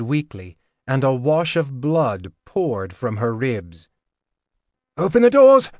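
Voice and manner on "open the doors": high, loud command; shouting. A narrator's reading. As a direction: bark it across the hall. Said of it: "Dorian shouted".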